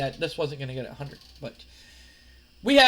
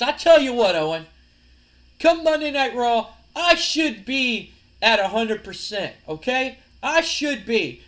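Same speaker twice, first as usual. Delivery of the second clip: raised-pitch, punchy promo voice; emphatic challenge acceptance; 6 semitones higher, 6 dB louder than usual. Acted. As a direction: accept a wrestling challenge with showman force. Acted champion accepting a challenge.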